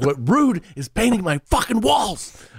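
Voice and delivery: high, slow exasperated yell; incredulous mock-furious outburst; retold outraged dad. Retold outburst at son over paint.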